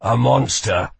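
rough, hoarse voice. Acted. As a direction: rasp it out hoarsely. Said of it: "said Kell hoarsely".

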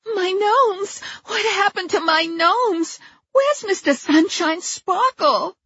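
very high, wailing over her gnomes; a heartbroken collector finding the wreckage; distraught Judy. Acted. Judy sees her smashed gnomes.

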